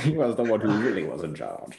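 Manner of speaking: put-on amused copy of an Australian-sounding accent; mimicked Australian accent. Castmate mimics the player's slip, mock Australian accent. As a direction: echo the accent back after a laugh.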